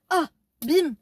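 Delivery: loud, sudden cry at the twist; surprised exclamation. Real, not acted. Telling her audience the stalker's identity, loud surprise. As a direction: shout out the shocking reveal. Reveal of the culprit.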